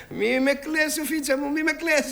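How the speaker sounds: high, teasing don't-pity-me; theatrical mock lament; mock self-pity. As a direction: brush off her pity with a grand flourish.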